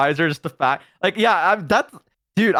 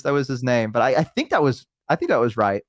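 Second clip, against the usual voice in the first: ordinary delivery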